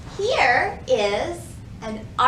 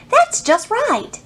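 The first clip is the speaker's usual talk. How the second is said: much higher, pleased and satisfied; beaming at the picture on the wall; happy; 9 semitones higher, 8 dB louder, slower than usual. Acted. Peter admiring his picture on the wall, happy. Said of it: "said Peter happily".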